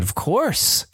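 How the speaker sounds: put-on short high kid reply; a light childlike yes; child voice. Dad imitating his son agreeing, lightly childlike.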